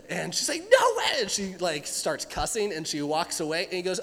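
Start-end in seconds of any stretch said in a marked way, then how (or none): 0.6-1.2 very high, louder shriek of disbelief; outraged disbelief. Retold or imitated. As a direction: shriek it like a furious teen. Girl outraged at fine.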